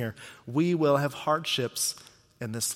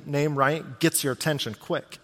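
ordinary delivery

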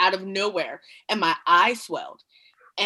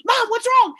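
worried son; 13 semitones higher, 6 dB louder than usual. Retold or imitated. Son startled by her shout.

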